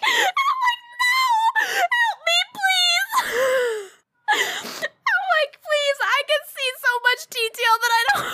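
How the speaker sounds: very high, tearful laughter; begging through hysterical laughter; laugh-crying. Real, not acted. Pleading with mom for help, crying with laughter.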